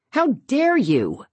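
high, slow, indignant cry; outraged on Ian's behalf; indignant exclamation. A narrator's reading. Lauren exclaiming at Beverly, high and slow. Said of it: "Lauren exclaims".

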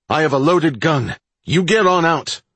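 loud and high, warning an intruder; shouting. Acted. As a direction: yell it out, gun in hand. Threatening intruder with loaded gun.

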